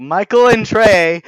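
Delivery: mock excited greeting. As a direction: call the names like eager scammers. Scammers greeting them by name.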